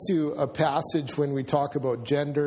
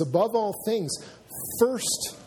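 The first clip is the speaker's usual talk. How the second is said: higher-pitched, urgent emphasis; 7 semitones higher than usual. Acted. Preacher quoting Paul's appeal to the church, urgent. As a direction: press it on the listeners as urgent. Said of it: "Paul is so urgent".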